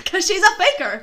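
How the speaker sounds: laughing it out high and loud. Real, not acted. Echoing her friend's jab, laughing. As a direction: giggle through the words.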